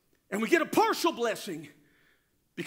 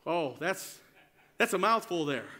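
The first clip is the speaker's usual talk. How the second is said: ordinary talk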